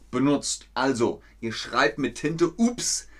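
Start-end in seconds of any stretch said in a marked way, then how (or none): 2.6-3.1 high, sudden little "oops"; a mock-startled oops, pitched up; acted oops. Teacher acting out an ink blot, acted oops.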